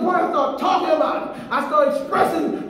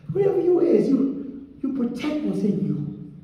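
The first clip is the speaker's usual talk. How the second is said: normal talking voice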